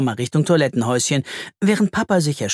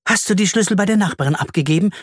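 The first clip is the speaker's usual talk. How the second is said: sudden mother question; 6 semitones higher, faster than usual. A narrator's reading. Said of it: "fragt sie plötzlich".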